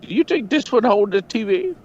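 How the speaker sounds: put-on a man in a store asks for help in a rough Egyptian accent; imitated Egyptian accent. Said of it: "I can't do a good Egyptian accent".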